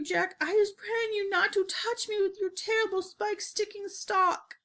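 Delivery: high, wailing begging; terrified giant pleading for his life; panicked screaming. A narrator's reading. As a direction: beg for mercy in a high-pitched wail. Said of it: "screamed the flesh lump eater".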